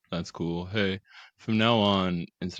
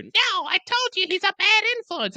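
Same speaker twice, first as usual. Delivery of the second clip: very high, loud, fast mock mom; hammed-up scolding mom, high and loud; shrieking mom; 6 dB louder, faster than usual. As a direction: screech it like an outraged mother.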